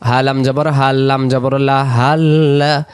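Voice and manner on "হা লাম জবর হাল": normal talking voice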